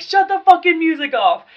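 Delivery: screaming. Retold or imitated. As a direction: yell over the music in a rage. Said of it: "I screamed at everybody".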